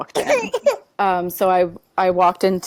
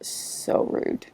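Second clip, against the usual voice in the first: whispered aside; 8 semitones higher, 8 dB quieter, much slower than usual. Real, not acted. Retelling the rude doctor.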